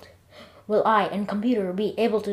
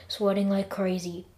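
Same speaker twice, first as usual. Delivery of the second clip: usual speaking voice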